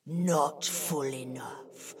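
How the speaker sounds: usual speaking voice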